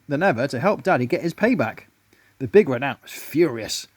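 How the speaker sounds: ordinary delivery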